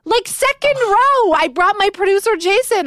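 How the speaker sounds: high, loud fan gush; gushing superfan, voice up high; excited. Real, not acted. Excited fan recounting the show.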